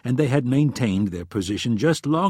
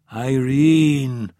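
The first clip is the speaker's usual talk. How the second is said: loud, drawn-out exasperated name; frustrated; 2 semitones higher, 6 dB louder than usual. A narrator's reading. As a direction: cut in with an exasperated, stretched call. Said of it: "let out a sigh of frustration".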